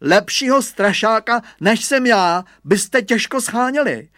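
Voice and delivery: put-on loud, high bragging boy; boastful. A narrator's reading. Hugo brags about being the best scarecrow, boastful. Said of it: "naparoval se Hugo".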